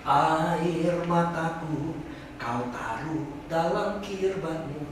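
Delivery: slow, solemn reading; a preacher reading Psalm 56 to the congregation, solemn; solemn scripture reading. Acted. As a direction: read the psalm slowly, like a prayer. Reads the psalm slowly.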